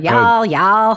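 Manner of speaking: mock Southern drawl on y'all, y'all; Southern accent attempt. Cohost teasing his Georgia partner in a mock Southern accent. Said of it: "[name]'s attempt at a Southern accent".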